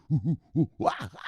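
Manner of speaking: lightly hooted ape calls; Kong voice. Acted. Streamer repeating his Kong voice, lighter ape hoots. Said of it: "my Kong voice".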